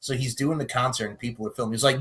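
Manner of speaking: ordinary talk, nothing marked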